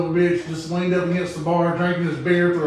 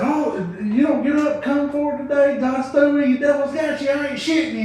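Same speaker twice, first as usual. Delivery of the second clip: loud, high hellfire preacher; preacher hollering at the congregation; 10 semitones higher, 4 dB louder than usual. Retold or imitated. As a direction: shout it like a fired-up country preacher. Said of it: "preacher said".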